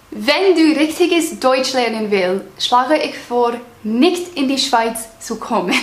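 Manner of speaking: slow, deliberate German, each word placed with effort; careful slow German. Shown as a demonstration. For her viewers, she slowly speaks German as a learner. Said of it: "I'm gonna say this part in German".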